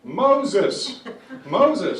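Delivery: loud, high parrot call; punchy mock parrot cry; joke parrot voice. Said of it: "the parrot goes".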